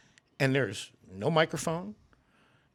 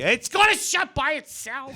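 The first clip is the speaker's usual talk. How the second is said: high, slow mock holler; hammed-up yelling in a high voice; imitated old folks' yell; 9 semitones higher, slower than usual. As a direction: holler it like irritated seniors. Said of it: "they all yell at me".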